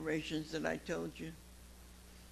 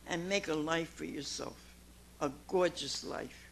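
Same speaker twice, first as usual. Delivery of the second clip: ordinary delivery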